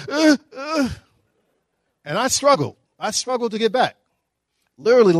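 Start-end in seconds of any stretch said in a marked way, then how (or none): 0.1-0.9 a high whimpering sob sound; mock ugly cry. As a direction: imitate a panicked ugly cry, pitched up. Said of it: "I got the, the ugly cry going on".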